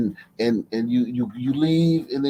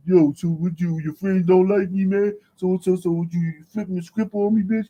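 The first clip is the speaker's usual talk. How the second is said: higher, hammed-up angry boyfriend voice; a mock hurt man calling her out, pitched up; confronted boyfriend; 6 semitones higher than usual. Boyfriend challenging her about her friends, in a higher mock-angry voice.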